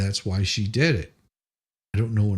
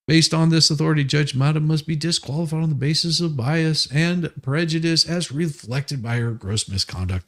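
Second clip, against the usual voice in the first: reading legal text aloud in a raised, pompous tone; mock formal brief reading; 5 semitones higher than usual. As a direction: declaim it like a self-important lawyer.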